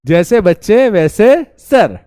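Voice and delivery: very slow, sing-song punchline; drawn-out mock saying. Teacher jokes to students he's one of them, very slow and drawn out. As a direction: stretch it like a filmi dialogue. Mock proverb line repeated.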